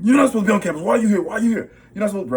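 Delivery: a campus worker screaming at the narrator in front of a crowd; yelling. Retold or imitated. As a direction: scream it like a man possessed. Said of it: "he starts yelling and saying".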